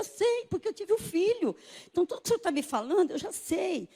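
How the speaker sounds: high-pitched, rushing protest; agitated insistence. Retold or imitated. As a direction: insist you already know, pitched high. Insisting to doctor, retold.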